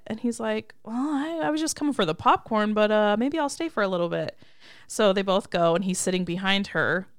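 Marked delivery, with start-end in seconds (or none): 0.8-4.4 higher, louder acted backtracking; softened annoyance. Retelling Nick deciding to stay for the movie, softened annoyance. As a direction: lightly imitate a man dropping his annoyance. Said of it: "the annoyance change a little bit".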